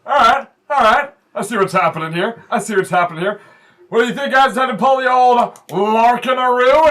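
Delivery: rally the crowd with swagger; boastful wrestler. Acted. GM voicing the wrestler bragging to the crowd. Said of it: "He goes".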